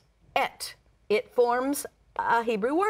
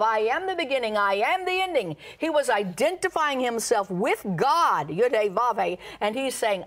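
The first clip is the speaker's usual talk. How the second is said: high, emphatic preacher's delivery; high-pitched sermon proclamation; impassioned preaching; 5 semitones higher than usual. Acted. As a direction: drive each declaration home from the pulpit. Preaches in Christ's words.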